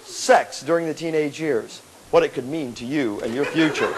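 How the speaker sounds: measured, quiet mock voiceover; mock educational narrator. Narrator addressing teens about their future, mock educational voice. Mocked narrator of teen talk.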